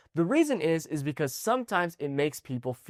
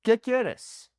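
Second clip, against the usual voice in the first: higher, lively question to a friend; casual to a friend; 8 semitones higher than usual. Shown as a demonstration. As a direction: ask it informally, pitched up high. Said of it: "let's say I'm talking to my friend".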